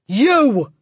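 high, sudden shout; Trunchbull. A narrator's reading. Headmistress picking on a boy, loud shout. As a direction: shout it to single out one pupil. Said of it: "the Trunchbull shouted".

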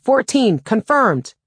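high, loud call, falling on each word; crisp procedural shout. A narrator's reading. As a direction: shout out a confirmed head count. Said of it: "I called out".

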